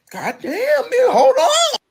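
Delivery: high, taken-aback exclamation; startled. Retold or imitated. Caught off guard by her friend's angry greeting, startled high voice. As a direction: flinch back at the yelling.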